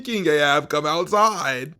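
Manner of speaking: loud, high, taunting call. Retold or imitated. Elijah daring King Ahab to come fight, loud taunting call. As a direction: shout him out like a street challenge.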